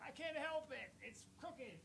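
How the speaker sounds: high, soft, innocent little voice; mock innocent whine. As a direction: plead innocence in a small high voice. His reply to coworkers, mock-innocent.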